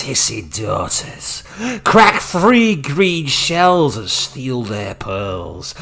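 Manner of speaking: put-on slow, creepy old crone voice; Granny Rags voice. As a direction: croon it like a sinister old witch. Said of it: "my Granny Rags voice".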